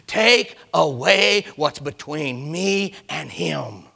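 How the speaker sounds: slow, emphatic preacher's command; forceful, drawn-out pulpit command. Acted. Acted command of Jesus at tomb.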